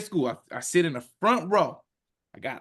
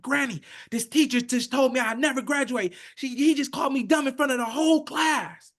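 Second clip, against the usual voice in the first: retold scream; 7 semitones higher than usual. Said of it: "I'm just screaming at her like".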